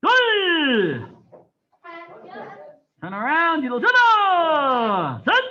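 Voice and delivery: loud Korean count called to the class; shouted count. Real, not acted. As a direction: bark the count so the whole room hears. Instructor calling counts and turns.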